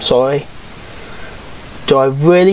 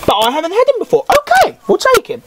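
squeaky mock voice; faster than usual. Reacting to a player pull.

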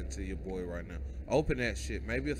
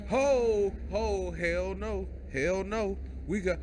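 being chased; 8 semitones higher, slower than usual. Real, not acted. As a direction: yelp it while fleeing. Fleeing monster in horror game.

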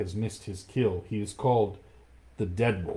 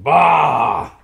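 much higher and louder roaring scoff; tiger roar Bah; 6 semitones higher, 14 dB louder than usual. Acted. Shere Khan roars contempt at Akela, loud scoffing Bah. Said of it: "Then Shere Khan roared".

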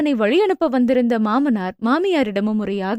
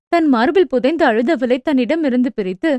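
usual speaking voice

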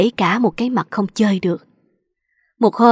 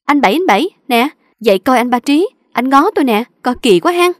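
louder, higher call to wake him, mocking; loud teasing call; 3 semitones higher, 4 dB louder than usual. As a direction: shout to the sleeper, poking fun at the man. Girl wakes coworker, teasing loudly. (gọi to, chế giễu, giọng cao)

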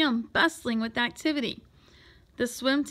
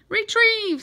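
loud, high call to a pet; calling a command; 10 semitones higher, 8 dB louder than usual. A narrator's reading. Boy sending his tadpole after a purse, calling a command. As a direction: call out the order.

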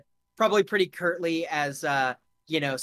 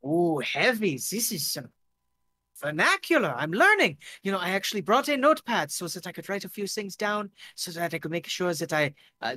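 put-on eager learner with a German-French accent; lilting, excitable German-French accent; 3 semitones higher than usual. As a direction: chatter keenly in a hammed-up Franco-German accent. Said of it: "he's switching between German and French".

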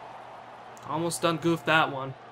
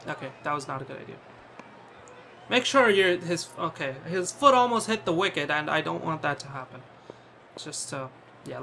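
ordinary delivery

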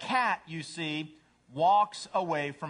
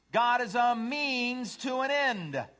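high, slow, emphatic cat; self-centered cat; 8 semitones higher, 4 dB louder, slower than usual. Acted. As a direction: proclaim it slowly, pitched up. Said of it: "A cat says".

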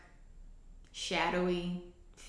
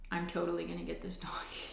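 hushed, half-whispered retold resolve; conspiratorial aside; 7 dB quieter than usual. Woman to her sister about the donkey, conspiratorial.

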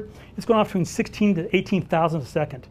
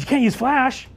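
higher-pitched imitation of an objecting official; mock objection; 7 semitones higher than usual. Retold official objects to flash.

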